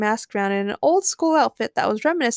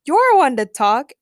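loud, higher, drawn-out retort; shouted retort; 5 semitones higher, 8 dB louder, slower than usual. A narrator's reading. Everyone answers Harley at once, shouting. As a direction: shout it back slowly. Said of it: "everyone shouted simultaneously".

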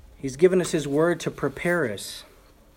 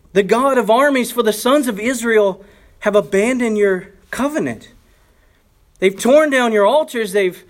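loud, higher anguished plea; crying out; 7 semitones higher, 6 dB louder than usual. Retold or imitated. Preacher voicing Elijah crying out to the Lord, loud and anguished. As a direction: pour out the complaint to God.

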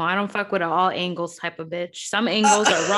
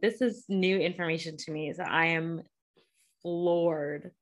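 normal talking voice

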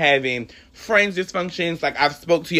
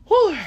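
high, loud breath-out whew; emotional whew; 5 dB louder than usual. Real, not acted. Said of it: "I had to get really emotional".

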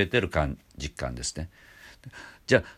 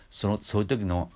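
normal talking voice

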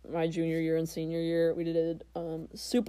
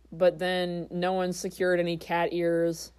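ordinary talk, nothing marked

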